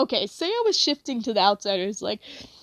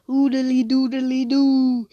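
slow nonsense singsong; mock friend singsong; 3 dB louder, slower than usual. Best friend rattling off band names, mock slow singsong. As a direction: tease the friend's babble. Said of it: "she's always just like".

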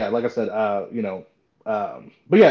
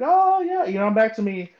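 elated; 10 semitones higher, 5 dB louder than usual. Retold or imitated. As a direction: celebrate being yourself, pitched way up. Retelling coming out of depression.

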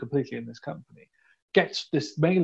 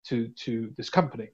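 ordinary delivery

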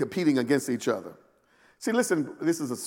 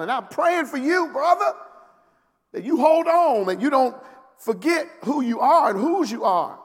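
urgent plea; 9 semitones higher, 3 dB louder than usual. Acted. Jesus pleading urgently with Peter.

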